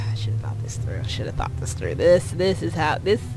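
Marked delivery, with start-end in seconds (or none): 0.1-2.0 low, despairing whisper; a hushed regretful whisper. Acted. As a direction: breathe it out in regret.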